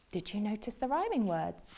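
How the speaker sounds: ordinary delivery, nothing marked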